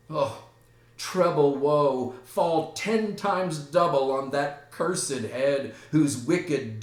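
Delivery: loud, declaimed lament; theatrical grief. Acted. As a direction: cry out the curse in stagy grief. Laertes grieves at sister's grave.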